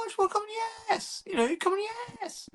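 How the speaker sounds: extremely high strained comic voice; imitating a stand-up, absurdly high; Mickey Flanagan. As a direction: push the mimicked punchline into falsetto. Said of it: "that Mickey Flanagan bit".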